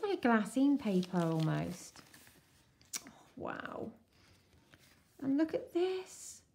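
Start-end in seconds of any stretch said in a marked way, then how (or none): 3.1-3.9 awed hush. Real, not acted. Awed at the old papers. 5.2-6.4 excited. Real, not acted. Excited at the letter card.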